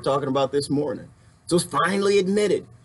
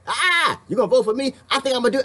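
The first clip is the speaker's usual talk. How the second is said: higher, lightly mocking politician asking for votes; 9 semitones higher than usual. Mocking a public servant asking for your vote, higher. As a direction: parody a glib candidate. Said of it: "Don't tell me that".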